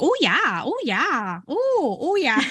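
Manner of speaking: sing-song Minnesota "oh, yeah" with big swooping pitch; Minnesota accent. Retold or imitated. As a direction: imitate a chatty Minnesota coworker, drawn out and melodic. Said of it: "She was like".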